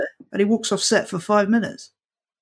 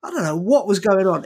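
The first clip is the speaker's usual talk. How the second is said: ordinary talk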